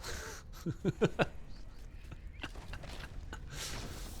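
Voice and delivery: soft, drawn-out chuckle; quiet laugh. Real, not acted. Streamer reacting to something fast, soft laughing. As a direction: laugh softly under the breath for a few seconds. Laughs after a quick moment.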